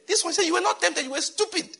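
high-pitched exasperated verdict; mock scolding. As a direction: scold in comic indignation. Acts God scolding the petty sinner.

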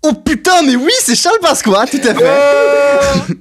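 high, drawn-out celebration of a right answer; excited outburst. Real, not acted. Host confirming a player's winning guess, excited and drawn out. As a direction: burst out high, stretching the final words.

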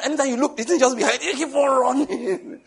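high, amused retelling that stretches out; laughing while telling. Real, not acted. Preacher joking about a running dream, laughing.

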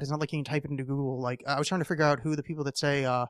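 ordinary talk, nothing marked

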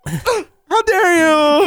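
high, very drawn-out wail; hammed-up tearful reproach; mock emotional. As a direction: stretch it into a fake sob. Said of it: "I start getting a little emotional".

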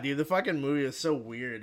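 ordinary talk

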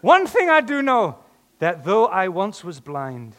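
very high, loud Irish lilt; leprechaun Irish accent. Acted. Preacher re-reads healed man's answer, loud leprechaun Irish. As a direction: proclaim it in a comic Irish brogue. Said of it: "Everything goes leprechaun".

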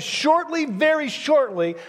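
Shortly leaps very high, then very shortly stretched out slowly; stress on shortly. Acted. Preacher paraphrasing the author of Hebrews, Shortly leaping high and drawn out. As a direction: hit Shortly high and drag very shortly. Same paraphrase from God's view.

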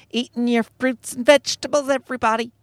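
high, prissy singsong mockery; mock dainty. Podcaster mocking dainty vegans skipping around town, high mock-dainty voice. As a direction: trip along in a high, dainty put-on voice. Mocks a dainty little diet.

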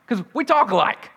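high, excited hailing of a fellow Southerner; excited recognition. Acted. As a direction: call out loud and high, stumbling with eagerness. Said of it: "you hear someone with a southern voice, you say".

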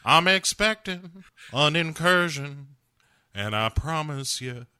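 hammed-up Southern last-stand speech; Uncle Jesse. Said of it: "And Uncle Jesse says".

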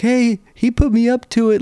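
put-on high-pitched protesting kid; high whine of a kid ratting out his buddy; tattling kid. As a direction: shift the blame like a caught kid. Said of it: "the one is like".

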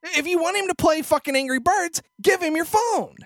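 high, loud, indignant; answering the lady in the store, a loud, indignant retort; heated retort. Retold or imitated. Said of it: "To which I said".

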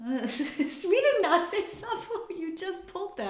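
high, slow laughing protest. Real, not acted. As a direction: laugh it out, teasing.

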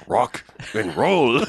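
"Rock and roll" drawn out very slowly in wonder; awed slow repeat. Retold or imitated. Hero tasting the new phrase, slow and awed. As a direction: savour each word of "Rock and roll" slowly. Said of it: "he's like".

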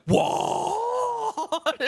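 high squeaky crying what; shocked grandma. Retold or imitated. Grandmother hearing the divorce news, shocked shriek. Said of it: "my grandmother was like".

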